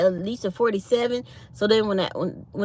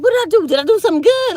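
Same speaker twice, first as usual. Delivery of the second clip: high and loud, baffled; surprised question; 10 semitones higher, 6 dB louder, much faster than usual. Retold or imitated. Retelling her startled reply to the boss's congratulations. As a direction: retell your confused reply, pitched way up. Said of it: "I was like".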